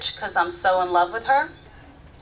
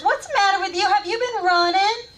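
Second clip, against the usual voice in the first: suspicious mama; 11 semitones higher than usual. Retold or imitated. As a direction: do a much higher, nosy mama voice. Mama scolds on the phone.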